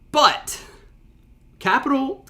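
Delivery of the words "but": "but" punched high and loud; "but" jumps up in pitch and volume; stress on but. Shown as a demonstration. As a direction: hit the word "but" hard, leaning on the capital B. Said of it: "But they start the sentence with".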